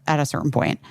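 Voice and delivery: ordinary talk, nothing marked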